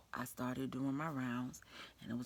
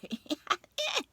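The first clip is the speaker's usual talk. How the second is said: high laugh; 7 dB louder than usual. Real, not acted. Laughing at coworker's rant.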